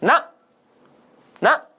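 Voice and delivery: each ना a single short burst; ना as one unbroken syllable; one-stroke syllable. Shown as a demonstration. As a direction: say ना on one stroke of breath, pause, then ना. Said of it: "श्वास के एक आघात में उच्चारण हो गया".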